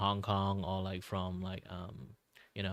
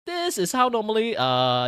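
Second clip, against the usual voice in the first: louder, much higher Malaysian English accent; 13 semitones higher, 9 dB louder than usual. Shown as a demonstration. Showing how Malaysians sound, switching into a loud Malaysian accent. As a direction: switch gear into a full Malaysian accent like Uncle Roger. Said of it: "think really hard about Uncle Rogers".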